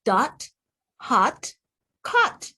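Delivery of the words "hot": ordinary talk, nothing marked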